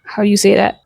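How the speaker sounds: ordinary talk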